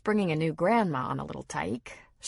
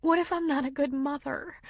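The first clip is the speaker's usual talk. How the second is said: tearful; 9 semitones higher than usual. A narrator's reading. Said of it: "eyes welled with tears".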